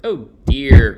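loud dismayed "oh dear"; dismayed cry. A narrator's reading. George watches his flying machine go, loud dismayed cry. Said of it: "cried George".

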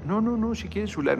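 high, pleading woman's voice, lightly put on; imitating compañera. Compañera insists, quoted.

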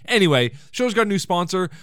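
normal talking voice

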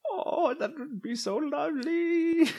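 put-on high, slow delighted old man. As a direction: gush like a frail old man.

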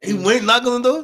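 loud, high disbelieving shout; can't believe it, shout it out; incredulous. Real, not acted. Friends hearing the boyfriend knocked on the door, incredulous shout. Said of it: "incredulous reaction".

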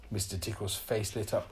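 usual speaking voice

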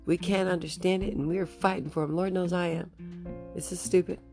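ordinary talk, nothing marked

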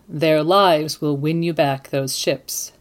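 ordinary talk

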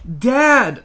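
high, loud, drawn-out appeal; whining protest. A narrator's reading. Child starts to object to Dad, in a whining protest. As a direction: wail it in protest. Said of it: "I start to protest".

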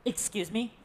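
soft, unsure "Excuse me?" with a rising end; puzzled question. Acted. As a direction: ask it quietly as if unsure you heard right. Repeated disbelieving question.

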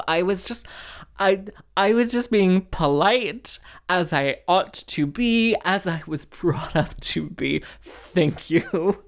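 hammed-up wounded dignity; mock indignation. Daughter insists to mother she was being polite, primly indignant.

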